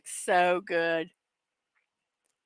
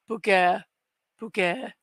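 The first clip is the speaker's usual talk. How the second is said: "Booger, booger" said lightly in a French accent, ending on an "-è" sound; a hint of French on "Booger". Shown as a demonstration. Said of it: "You gotta say it with a French accent".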